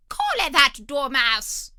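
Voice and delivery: loud, slow royal shriek; a furious queen ordering arrests. A narrator's reading. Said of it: "the Queen shrieked out".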